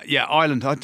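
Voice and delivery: ordinary delivery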